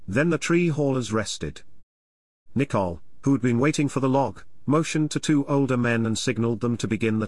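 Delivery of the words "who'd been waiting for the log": usual speaking voice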